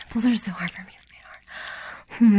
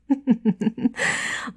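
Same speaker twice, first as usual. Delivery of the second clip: higher, teasing little laugh; taunting chuckle; 4 semitones higher than usual. Acted. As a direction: chuckle as a playful threat. Teasing the partner after a warning.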